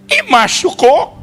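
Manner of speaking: high, very slow worried coo; fussing mother. Retold or imitated. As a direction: stretch it out like a doting mom. Mother asks the son.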